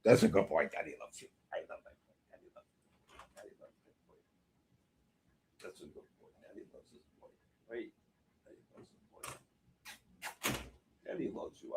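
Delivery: tender whisper to a beloved dog; tender whispered praise. Real, not acted. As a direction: whisper sweet nothings to your pet. Crooning to his dog.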